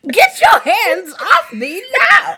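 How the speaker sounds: high, slow shrieking Karen; entitled Karen voice. Pretend. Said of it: "full Caucasian woman".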